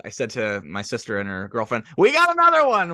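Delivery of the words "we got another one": high, loud gleeful yell; celebratory cheer. Retold or imitated. Host celebrating a new guest to sister, high triumphant cheer. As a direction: cry it out in triumph. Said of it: "how I always celebrate".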